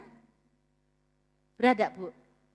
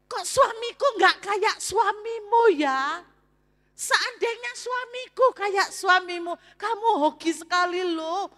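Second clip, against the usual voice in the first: lightly voiced envious friend, louder; 7 semitones higher, 4 dB louder than usual. Friend wishes her husband were this attentive, envious. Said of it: "Anda tahu komentar temannya".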